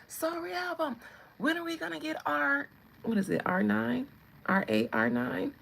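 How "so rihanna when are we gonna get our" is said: high-pitched mock fan voice; pestering fans. Fans asking the singer for the album, high mock-eager voice. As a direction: imitate needy fans, pitched up. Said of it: "Every time y'all be like".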